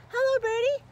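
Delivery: high, loud, sing-song hello; excited high greeting. Acted. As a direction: call out sweetly to the bird. Greeting bird.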